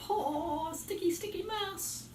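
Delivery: slow high sing-song; high squeaky. Real, not acted. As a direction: squeak it out playfully. Hands covered in goo.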